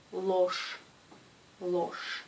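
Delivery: "ложь" ending in an sh sound; final sh sound. Shown as a demonstration. Teacher models the word for students, final sh. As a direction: say "ложь" with an sh at the end. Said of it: "ce mot se prononce".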